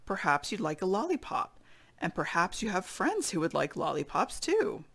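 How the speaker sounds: ordinary delivery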